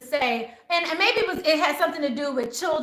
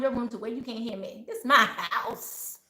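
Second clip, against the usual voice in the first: stern mom; 5 semitones lower, 6 dB quieter than usual. Acted. Mom laying down house rules.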